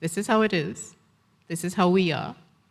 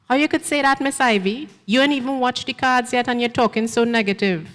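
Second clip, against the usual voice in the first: put-on indignant young woman; 6 semitones higher, 4 dB louder than usual. Gail protests to Miss Ivy.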